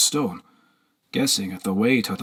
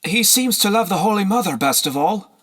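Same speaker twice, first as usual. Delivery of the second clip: higher, slightly irritated; vexed girl voice; 10 semitones higher than usual. A narrator's reading. Slave answers, vexed.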